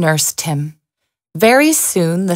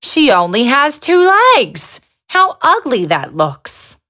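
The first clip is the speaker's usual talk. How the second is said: lightly voiced beetles, higher on the first line; a hint of snooty insects mocking her; sneering beetles; 5 semitones higher than usual. A narrator's reading. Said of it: "turned up and said".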